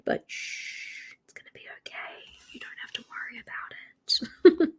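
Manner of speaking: soft conspiratorial whisper; hushed whisper. Acted. Hushing her own worry to chat, whispered. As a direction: whisper it like a secret to yourself. Hushing herself about too many.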